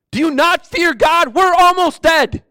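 high, loud, urgent shout; yelling. Acted. Dying thief yelling at the other thief, shouting. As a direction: shout the rebuke at full force. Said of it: "He's literally yelling at the other thief".

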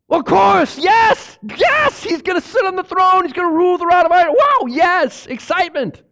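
gushing, hammed-up enthusiasm; excited yes. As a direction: play the thrilled believer. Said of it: "are Christians excited about the millennial kingdom, yes or no?".

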